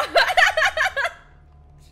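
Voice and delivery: high, loud burst of startled laughter; loud laughing shriek. Real, not acted. Startled by the game.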